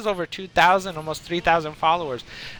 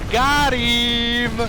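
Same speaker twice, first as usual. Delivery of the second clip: gloating prankster; 7 semitones higher, 4 dB louder than usual. Retold or imitated. Prankster friend's gleeful quote.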